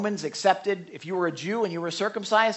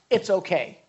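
ordinary talk, nothing marked

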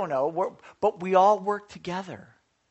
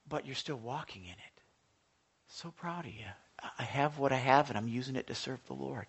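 much softer, half whispered; tender whisper; 4 semitones lower, 7 dB quieter than usual. Real, not acted. Pastor encouraging Nathan at breakfast, whispered. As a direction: encourage him gently and quietly.

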